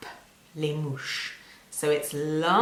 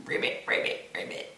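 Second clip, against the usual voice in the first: whispered frog ribbits; quiet ribbit sounds; frog croak; 4 dB quieter, faster than usual. Shown as a demonstration. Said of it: "Can you pretend to be a frog?".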